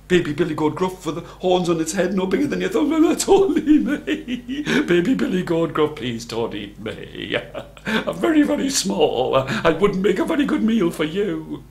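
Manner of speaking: tiny pleading goat, pitch leaping up; scared little creature with a nervous giggle; frightened baby goat. A narrator's reading. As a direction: beg the troll for mercy.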